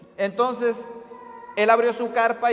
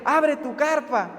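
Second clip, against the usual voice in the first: higher, drawn-out calling out; acted excited call; 5 semitones higher, slower than usual. Youth leader telling the girl to open her tent, excited. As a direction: call slowly and eagerly to someone nearby. Tells girl to see rain stopped.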